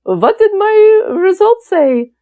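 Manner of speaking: high, slow imitated Russian-accented dad; imitation of an eager Russian father. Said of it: "in a Russian accent".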